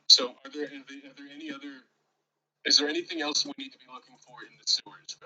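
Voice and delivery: normal talking voice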